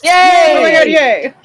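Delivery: high, loud, delighted squeal; excited cheer. Real, not acted. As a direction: burst out cheering at great news. Players cheer reaching level five.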